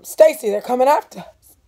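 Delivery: high, loud frightened cry; panicked. Acted. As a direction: blurt it out in fright. Cassie panicking to brother.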